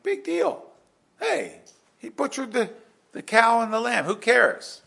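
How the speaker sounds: mock listener shrugging off the sacrifice, high and flippant; dismissive shrug. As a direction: wave it away like it's nothing. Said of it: "That's what we might think".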